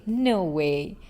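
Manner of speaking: usual speaking voice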